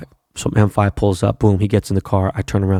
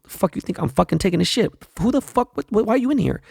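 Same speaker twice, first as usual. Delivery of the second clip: mock outrage pitched way up; indignant protest; 11 semitones higher than usual. Man on the toilet to an intruding cop, indignant high-pitched protest.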